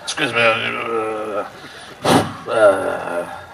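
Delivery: a comic's mock church announcement; vicar announcing a hymn.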